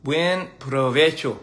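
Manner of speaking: "Buen provecho" spoken slowly, each syllable given; slow deliberate learner's phrase; careful Spanish practice. Shown as a demonstration. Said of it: "All right".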